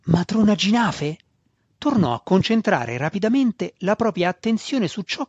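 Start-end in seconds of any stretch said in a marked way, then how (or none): 0.0-1.2 excited whisper. A narrator's reading. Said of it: "il sussurro eccitato di Alton".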